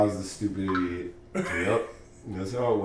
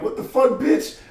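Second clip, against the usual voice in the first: much higher mock-outraged yelp; shocked outburst; 14 semitones higher, 4 dB louder than usual. In bed, he yells at the girl in mock outrage. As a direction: jump up startled and offended. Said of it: "I'm like".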